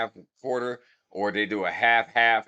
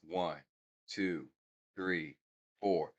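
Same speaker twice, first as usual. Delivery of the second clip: slow counting; 8 semitones lower, 12 dB quieter, slower than usual. Shown as a demonstration. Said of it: "if it's a slower beat".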